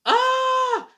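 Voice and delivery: very high, loud mock scream "Ah!"; imitated scream. Husband imitating his wife's scream from the other room, loud and high. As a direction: shriek it like the wife from another room. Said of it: "Amy would be yelling from the other room".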